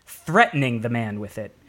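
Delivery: ordinary delivery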